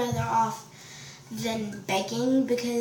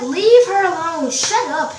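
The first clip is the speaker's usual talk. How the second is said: loud, raised-pitch retold order; mock scolding; 4 semitones higher, 9 dB louder than usual. Boy next to her defends classmate, mock scolding. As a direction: imitate a boy loudly butting in.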